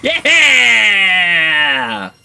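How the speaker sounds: high, loud, drawn-out victory yell; triumphant cheer. Real, not acted. Finally succeeds in game.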